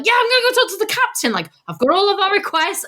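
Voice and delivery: high, eager mock complainer; self-parody complainer. Podcaster playing themself marching off to the captain, high-pitched mock-eager. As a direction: pipe up as the one who volunteers to complain. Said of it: "I'd be the one like".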